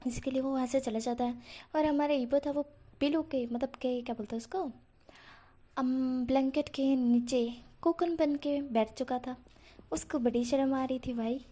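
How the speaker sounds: ordinary delivery, nothing marked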